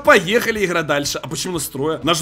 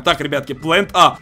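ordinary talk, nothing marked

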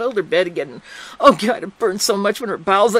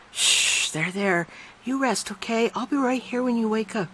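soothing; 5 semitones higher, 5 dB quieter than usual. A narrator's reading. Soothing the girl to sleep.

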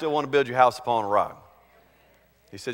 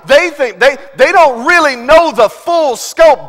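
high, loud, drawn-out preacher's delivery; full-force preaching; 9 semitones higher, 12 dB louder, slower than usual. Acted. Preacher urging the congregation to see people as Christ does, full force. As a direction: preach it with big punched words.

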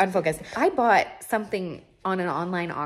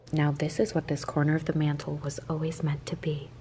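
awed whisper; 2 semitones lower, 6 dB quieter than usual. Real, not acted. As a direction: whisper it reverently. Admiring mantle.

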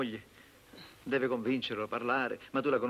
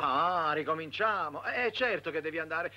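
raised, higher-pitched exasperation; exasperated; 5 semitones higher, 3 dB louder than usual. Acted. Sandro pushing reluctant priest to go, exasperated. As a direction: scold the reluctant one, voice climbing.

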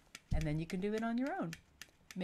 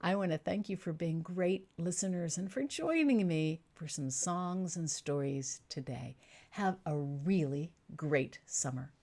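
ordinary talk

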